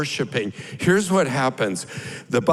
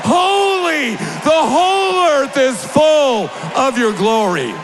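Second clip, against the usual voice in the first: high, loud shouted holies; shouted praise; 11 semitones higher, 4 dB louder, slower than usual. Acted. Preacher voicing the angels, shouting holy high and loud. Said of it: "And they say".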